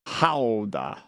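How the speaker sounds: Gouda with a throaty H-like start, HOW-da; the Dutch way to say Gouda, HOW-da. Shown as a demonstration. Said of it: "How to pronounce, okay, here it is".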